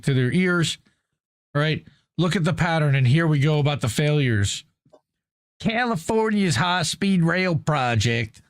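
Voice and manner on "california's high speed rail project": put-on slow, drawn-out Southern American accent. Reading an article aloud to the chat, in a put-on Southern accent. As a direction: put on a lazy Southern drawl for the start of the claim. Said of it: "I don't know why I said that in Southern accent".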